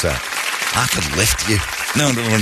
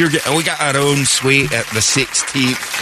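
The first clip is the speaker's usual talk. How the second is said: Scottish brag; 8 semitones higher than usual. Retold or imitated. Quoting the Scotsman's package brag.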